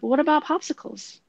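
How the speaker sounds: high child-like retold request; young son. Eight-year-old asking a parent for a snack, lightly voiced child.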